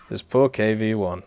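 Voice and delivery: ordinary talk, nothing marked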